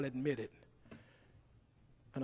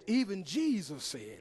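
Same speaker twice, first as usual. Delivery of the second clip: ordinary delivery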